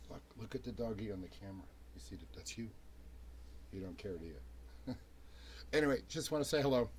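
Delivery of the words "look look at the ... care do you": talking to a dog. Real, not acted. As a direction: coo softly at the dog. Showing puppy the camera.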